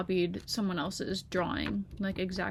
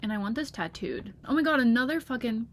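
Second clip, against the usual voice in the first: ordinary delivery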